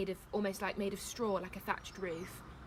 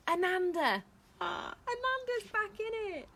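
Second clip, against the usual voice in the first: high, squealed exclamation; excited delight; 13 semitones higher, 5 dB louder than usual. Real, not acted. Reader reacting to Ananda's trumpeting, excited. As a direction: exclaim over a favourite returning. Reader greets elephant's return.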